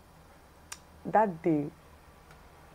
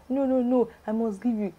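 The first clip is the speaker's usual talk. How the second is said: acted insistence; 6 semitones higher than usual. Caller pressing money on a friend, imitated insistence. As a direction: insist over the objection, pitched up.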